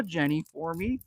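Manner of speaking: usual speaking voice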